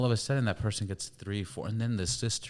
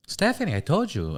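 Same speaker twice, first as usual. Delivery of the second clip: impatient mom; 8 semitones higher, 4 dB louder than usual. Retold or imitated. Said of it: "She'll be like".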